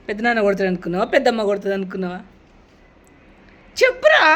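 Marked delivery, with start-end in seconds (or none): 3.8-4.2 high, loud demand; insistent shout. Real, not acted. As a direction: push them hard for an answer. Pressing silent boy to answer.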